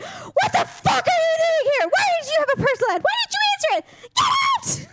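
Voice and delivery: hammed-up, high-pitched shrieking outrage; outraged yelling. Acting out catching a spouse through a personal ad, hammed-up fury. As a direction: fire off furious questions, very high, up to a yelled order.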